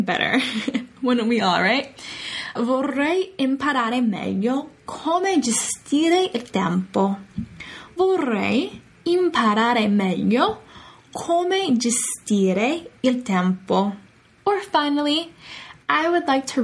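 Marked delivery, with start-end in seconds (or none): 2.5-7.2 slow model phrase. Shown as a demonstration. 8.0-13.9 syllable by syllable for learners; very slow model phrase. Shown as a demonstration.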